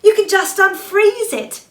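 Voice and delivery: pleading sister voice; Anna pleading. Acted. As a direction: coax your sister eagerly. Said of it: "she said".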